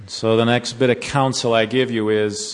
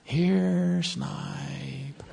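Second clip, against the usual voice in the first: a hushed call, snipe stretched out and fading; soft coaxing call; 3 semitones higher, 6 dB quieter than usual. Retold or imitated. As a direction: lure the snipe in softly in the dark. Uncle calls snipe in dark.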